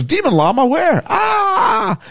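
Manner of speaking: high frantic yelp; panicked Cusco. Retold or imitated. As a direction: shriek it in sudden panic. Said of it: "Cusco goes".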